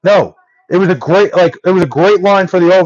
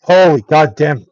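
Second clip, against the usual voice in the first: normal talking voice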